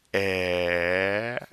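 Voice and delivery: "air" held long, mouth unmoving; slow demonstration of "air" with French R; French R demo. Shown as a demonstration. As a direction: stretch "air" into a breathy French R at the back. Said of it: "French R formed by saying".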